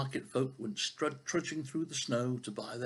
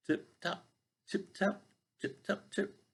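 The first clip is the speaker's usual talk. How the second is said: tapping sound; 9 semitones higher, slower than usual. Acted.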